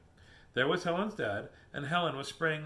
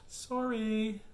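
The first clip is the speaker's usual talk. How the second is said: high, stretched-out "sorry"; singsong apology; 6 semitones higher than usual. Acted. Helen to the wolf she sprayed, high singsong apology. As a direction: draw it out high, like an oops.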